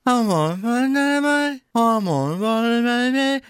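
put-on high, drawn-out toddler babble in a Belfast accent; wordless train-hoot singsong with Belfast vowels; Belfast accent gibberish. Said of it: "You can hear that Belfast accent".

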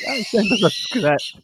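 high, drawn-out mock plea; mock BBC reply. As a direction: send it up, high and slow. Mimics the BBC's reply.

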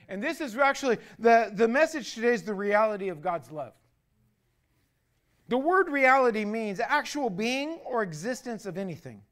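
loud, emphatic preaching. Acted. Pastor opening the sermon to his congregation, loud preaching. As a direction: drive the message home from the pulpit.

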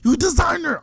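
high-pitched mock outrage; retold rapid, high indignant challenge; indignant scolding. Acted scolding of the designer.

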